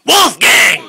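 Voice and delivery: loud sharp yell; shout. Real, not acted. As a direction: yell the name across the room. Parent shouting at other child.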